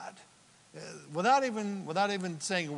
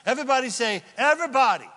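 higher, lifted prompt to a crowd; calling out; 7 semitones higher, 6 dB louder, much faster than usual. Real, not acted. Preacher getting the congregation to repeat a word, calling out. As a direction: call it out for everyone to echo.

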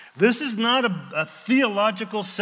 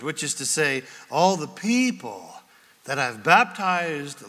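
usual speaking voice

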